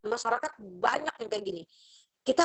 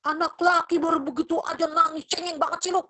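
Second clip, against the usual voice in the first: harsh parent; 6 semitones higher, 3 dB louder than usual. Retold or imitated. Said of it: "orang tua memperlakukan anak dengan keras".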